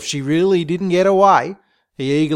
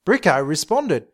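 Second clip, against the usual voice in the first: ordinary delivery, nothing marked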